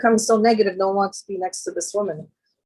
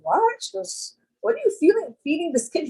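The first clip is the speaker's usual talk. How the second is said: high-pitched mock disbelief; retold astonishment; 9 semitones higher than usual. Mother reacting to a friend's huge baby, high incredulous questioning. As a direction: act out being taken aback, pitch leaping up. Acted shock at the enormous baby.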